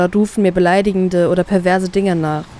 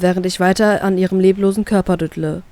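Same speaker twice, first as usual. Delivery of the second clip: ordinary delivery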